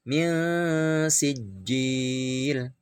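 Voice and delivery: min sijil with the nun hidden and a short hum; ikhfa. Shown as a demonstration. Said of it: "samar, ada dengungnya". (min sijil dengan ikhfa, samar berdengung)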